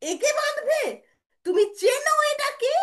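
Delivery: very high, loud disbelief; outraged challenge. Acted. Brother asking mother if she knows Krishna, soaring outrage. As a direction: shout the question, voice soaring.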